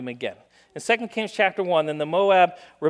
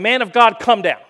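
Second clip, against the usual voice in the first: loud, higher summons; soldiers calling out; 4 semitones higher, 8 dB louder than usual. Acted. Captain shouting up to Elijah on the knoll, loud call. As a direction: call him down off the hill. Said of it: "they say to him".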